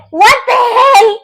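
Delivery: high, loud what the hell; mock outraged ex. Ex-boyfriend shocked that she moved on, mock outrage. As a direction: play the shocked ex, hammed-up. Ex imitated seeing her move on.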